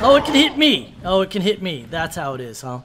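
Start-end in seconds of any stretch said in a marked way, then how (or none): 0.0-1.0 higher, louder startled cry; surprised realisation. Real, not acted. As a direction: caught off guard by the hit. Surprised when the creature hits him.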